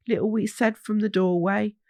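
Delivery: ordinary delivery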